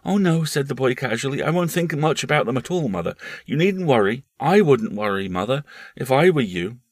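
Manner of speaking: put-on a boy brushing it off; casual boy.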